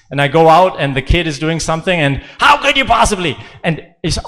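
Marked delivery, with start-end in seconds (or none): 2.4-3.3 raised, louder, exasperated parent snapping; angry at the kid. Retold or imitated. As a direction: scold the kid in mock outrage. Angry parent right after confession.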